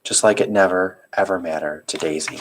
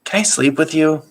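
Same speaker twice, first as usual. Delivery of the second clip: put-on high, timid little boy; small child voice; 9 semitones higher than usual. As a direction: ask like a sleepy young boy. Child voice in story.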